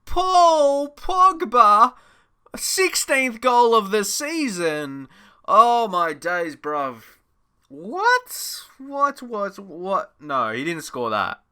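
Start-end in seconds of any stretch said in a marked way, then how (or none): 0.0-7.0 high, drawn-out cries of disbelief; can't believe what you just saw; slow, amazed shouts at a wonder goal; stunned disbelief. Real, not acted. 7.7-10.1 high, squeaking what, falling away; fading gasps of disbelief; disbelieving whats. Real, not acted. Disbelief at the goal.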